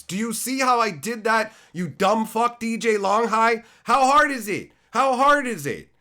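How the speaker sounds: high, loud mocking taunt. Real, not acted. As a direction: shout the taunt at him.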